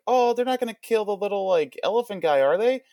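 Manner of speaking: high-pitched mock worried wife; wife's concerned question, voiced high; imitating wife. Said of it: "Missus Murphy was like".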